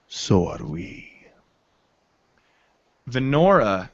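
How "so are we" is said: slow, lower dwarf reply; terse dwarf. Acted. Dwarf answers the elf's digging plan, slow and low. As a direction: draw it out low, lightly voiced.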